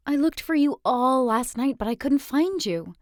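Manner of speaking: bubbly best friend voice; excited friend. Acted. Friend bursts in eagerly.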